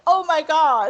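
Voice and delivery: high, loud "oh my God"; amazed reaction. Retold or imitated. Fan reliving the Hulk scene, high loud amazement. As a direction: gasp it out in delight. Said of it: "And it's like".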